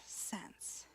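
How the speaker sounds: slow hushed whisper. Real, not acted. Preacher hushing the congregation into a whisper. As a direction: drop to a whisper to land it. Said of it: "whispered close of point".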